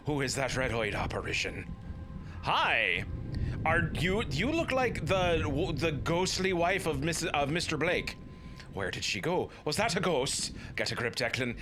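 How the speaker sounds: normal talking voice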